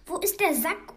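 high, loud eager question; greedy eagerness. A narrator's reading. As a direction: ask it hungry for the sack. (begierig, laut und hoch)

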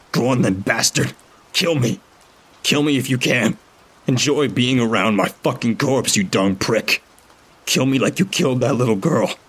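high, raging shouted dare; furious taunt. A narrator's reading. Narrator raging at Toggs mid-fight, furious. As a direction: spit the challenge at him.

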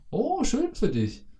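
very high, slow sing-song "Oh, schön für dich"; played-up fake joy. Showing the played-up reply, high and slow. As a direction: stretch it high and syrupy, clearly fake. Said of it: "dieses gespielte".

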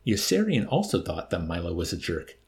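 plain reading voice

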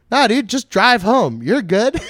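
high, loud, drawn-out brush-off; mock carefree urging. Army drinking buddies urging him to drive, mock-carefree. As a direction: imitate buddies waving away a worry. Soldiers brush off worry, acted.

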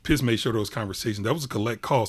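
ordinary talk, nothing marked

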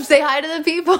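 laughing, calling out to a ghost; playful, laughing greeting to the spirit. Real, not acted. Jokingly addresses the spirit.

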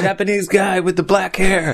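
high-pitched mock holler; imitated yelling. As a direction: pretend to single out a stranger from afar. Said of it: "すごい大きい声で怒鳴ってんの".

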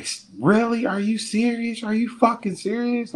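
high-pitched indignant woman; mimicked disbelief. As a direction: imitate her outraged questioning.

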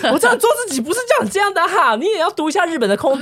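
high-pitched exasperated scolding, stressing 也 and 要. Retold or imitated. 对拍照的朋友又急又气地训话，声音拔高.

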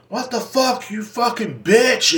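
hammed-up road rage shout; mock angry yell. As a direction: play the furious driver cussing out the other. Drivers quarrel in parking lot.

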